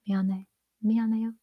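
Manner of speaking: put-on a weak stab at an Aussie accent; fumbled Australian accent. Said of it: "I can't do Aussie".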